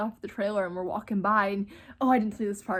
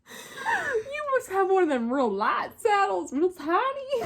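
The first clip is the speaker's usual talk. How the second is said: put-on cowboy accent; 10 semitones higher, 3 dB louder than usual. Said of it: "I'm not making the accent up".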